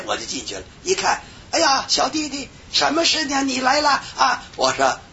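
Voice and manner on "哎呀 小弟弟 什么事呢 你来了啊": voicing a kindly older woman. Voicing the older sister's greeting.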